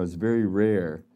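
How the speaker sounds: usual speaking voice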